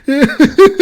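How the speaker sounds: loud, high, through real laughter; laughing. Real, not acted. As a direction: crack up while saying it. Cracking up at the co-host joke.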